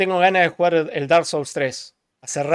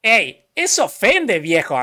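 indignado en broma, más agudo; mock offended; 2 semitones higher, 4 dB louder, slower than usual. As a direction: protesta ofendida y exagerada. (ofendido en broma)

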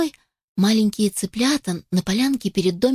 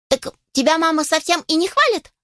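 surprised chicks; 8 semitones higher than usual. Acted.

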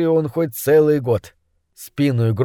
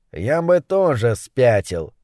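ordinary delivery, nothing marked

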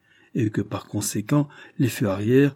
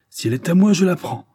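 higher and louder, a sudden outburst; exclaiming; 9 semitones higher, 6 dB louder than usual. Acted. Drishna to Carados, snatching the ruler: loud higher outburst. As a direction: blurt it out as you grab the ruler. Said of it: "s'exclama-t-il".

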